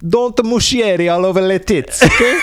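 high-pitched, hammed-up Italian accent; mock Italian accent. Co-hosts riffing on the director's name, mock Italian accent. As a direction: clown it up as an exaggerated Italian.

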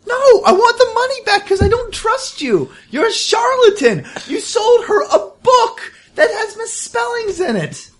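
very high, indignant squawk; hammed-up outrage, pitch shot way up; outraged complaint. Cheated customer confronting the merchant over a book, outraged.

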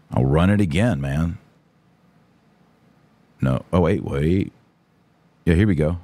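ordinary delivery

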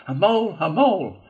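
high, loud battle cry; repeated high warrior's yell; war cry. A narrator's reading. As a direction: shout it as you charge. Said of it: "shouting his awful war cry".

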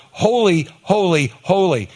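angels calling out. Retold or imitated. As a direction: call out Holy, holy, holy like the angels. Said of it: "calling out".

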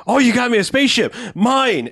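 high-pitched excited brat impression; grabby kid. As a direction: exclaim like a greedy, unruly child. Bratty kid grabbing the spaceship.

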